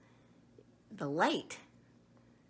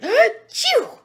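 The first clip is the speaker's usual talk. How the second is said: gasp then sneeze; 18 dB louder than usual. Acted. Monkey sneezes again in story.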